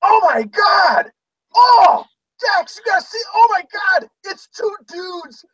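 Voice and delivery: high-pitched imitation of a shrieking roommate; hammed-up scream of shocked discovery; mock screaming. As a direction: retell his screaming in a high, drawn-out wail. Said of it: "he starts screaming".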